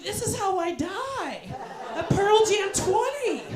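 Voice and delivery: high, slow doomed cry; hammed-up dying lament, drawn out; melodramatic despair. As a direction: wail it like dramatic last words. Melodramatic cry lying trampled.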